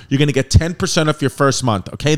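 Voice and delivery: loud, punchy podcast ad read; sponsor ad read. Acted. Host reading the BetterHelp sponsor spot, loud ad voice. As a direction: sell it straight to the listeners, loud.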